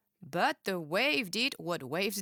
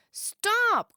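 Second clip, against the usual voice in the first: high loud stop; a sharp high cry; 11 semitones higher, 6 dB louder than usual. A narrator's reading. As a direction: shout it in alarm. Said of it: "cried Summer".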